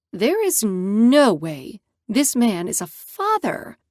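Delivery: ordinary delivery, nothing marked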